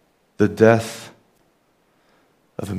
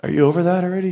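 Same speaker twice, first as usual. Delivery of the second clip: wife's teasing question; 9 semitones higher, 4 dB louder, much faster than usual. Retold or imitated. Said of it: "she's like".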